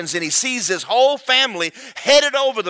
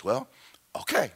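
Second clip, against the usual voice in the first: soft, low, hesitant; reluctant agreement; 6 semitones lower, 8 dB quieter than usual. Acted. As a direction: give in quietly after a pause. Creature reluctantly agrees to lion.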